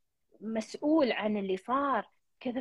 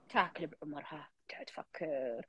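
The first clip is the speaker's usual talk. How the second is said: softly whispered, lower; 7 semitones lower, 8 dB quieter than usual. Retold or imitated. Counselor whispering how sad people withdraw, hushed. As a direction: breathe it as if to yourself.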